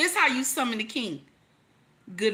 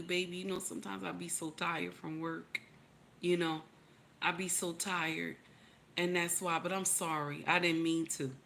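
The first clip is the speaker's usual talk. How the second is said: lower, much softer, tired apology; sweet apologetic husband; 6 semitones lower, 10 dB quieter than usual. Acted. Husband apologizing to his wife, softly and meekly. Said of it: "he'll tell you".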